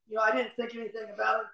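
ordinary talk, nothing marked

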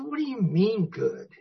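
high-pitched baffled protest; retold indignation. Newcomer challenging the chuckling sponsor, indignant. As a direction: throw it back in mock disbelief.

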